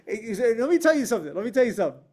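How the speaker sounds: ordinary talk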